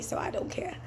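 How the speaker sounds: whispered shrug; whispered throwaway not-my-problem. Real, not acted. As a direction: breathe it out as an unvoiced aside. Trailing dismissive close.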